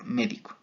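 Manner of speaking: "médico" said ME-di-co with the stress on the first syllable me. Shown as a demonstration. Student giving the esdrújula form, "médico" stressed on me. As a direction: lean on me in "médico", as in the noun. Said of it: "tilde igualmente en la antepenúltima sílaba".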